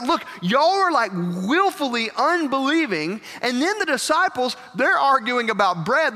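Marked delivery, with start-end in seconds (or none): 0.0-3.2 exasperated rebuke. Retold or imitated. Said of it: "And he's like".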